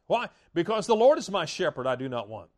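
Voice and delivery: normal talking voice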